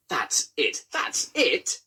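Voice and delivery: rising indignant splutter, pitch jumps. A narrator's reading. Inspector outraged at being questioned, spluttering high. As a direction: choke on outrage. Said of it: "spluttered the inspector".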